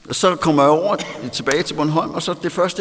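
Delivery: usual speaking voice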